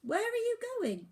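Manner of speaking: put-on boy voice. A narrator's reading. Oliver asks bird.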